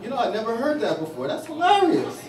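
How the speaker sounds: dry sarcastic reply, jumping high on hilarious; deadpan mock amusement; sarcasm, feigned delight. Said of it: "I go".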